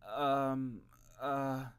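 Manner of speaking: hesitant high um; stuttering. A narrator's reading. Middle brother stalls before mom. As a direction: stall nervously. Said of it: "stuttered his middle brother".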